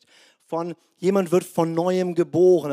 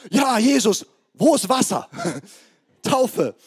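high, loud, hammed-up enthusiasm; eager convert; 6 semitones higher, 4 dB louder than usual. New believers begging the pastor for baptism, playfully overexcited. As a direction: burst out like an overjoyed new believer.

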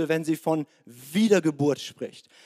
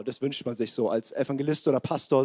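ordinary talk

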